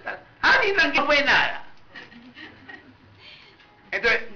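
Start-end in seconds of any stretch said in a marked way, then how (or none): 0.4-1.6 high, airy fake humility brushing it off; preacher mocking a believer who says it was nothing; false humility. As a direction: brush off the apology with put-on modesty. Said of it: "fingiendo una falsa humildad".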